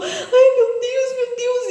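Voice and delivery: high, tearful slow cry. Retold or imitated. Woman crying out to God in despair, tearful. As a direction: sob out a plea to God. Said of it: "E eu chorando".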